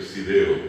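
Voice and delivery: put-on boy repeating "Sideo" with an S for F; imitated child's "Sideo", S for F. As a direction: say "Sideo" with S where F should be. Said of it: "él volvió a repetir".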